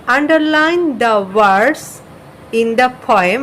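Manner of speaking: slow, careful English read-aloud; reading the instruction slowly. Shown as a demonstration. Teacher reading the textbook line slowly to students. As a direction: read each word out slowly for the class. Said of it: "এখানে বলছে".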